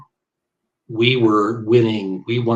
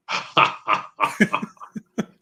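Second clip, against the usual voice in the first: imitated scoffing laugh; staccato put-on laugh; mocking laugh; 3 semitones higher than usual. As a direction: laugh in his face, as an impression. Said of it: "And he would just".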